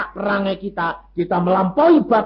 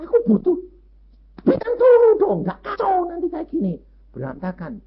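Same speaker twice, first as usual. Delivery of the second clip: mock quarrel voice; 11 semitones higher than usual. Voices in a household argument.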